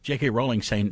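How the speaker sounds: usual speaking voice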